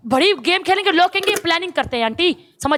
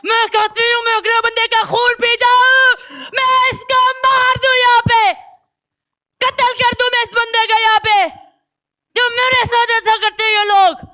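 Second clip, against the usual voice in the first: angry outburst; 5 semitones higher than usual. Real, not acted. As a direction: spit out the threats in rage. Venting rage about punishment. (गुस्से में ऊँची आवाज़ में चिल्लाना)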